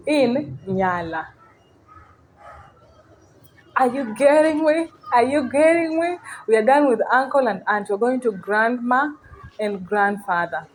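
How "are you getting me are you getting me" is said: high-pitched, repeated check; pitched-up teacher's check for understanding; insistent checking question. Real, not acted. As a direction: ask it high, pressing the learners. Teacher repeatedly checks learners follow.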